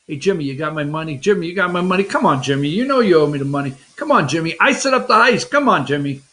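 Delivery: low, hurried nagging for money; put-on pest, pleading and insistent; pestering Morty. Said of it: "he wouldn't leave what's-his-name alone".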